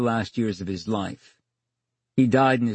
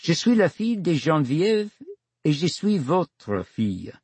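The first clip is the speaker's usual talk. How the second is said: daughter speaking French; 5 semitones higher than usual. Acted. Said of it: "And she said".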